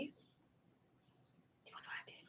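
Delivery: slow, hushed whisper; teasing, drawn-out whispered question; conspiratorial whisper. Real, not acted. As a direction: whisper it slowly like a secret. Teasing repeat, whispered to the audience.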